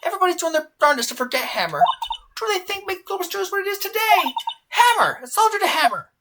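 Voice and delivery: high-pitched, worked-up tirade; indignant rant. Acted. Old studio guard defending Hammer, indignant rant.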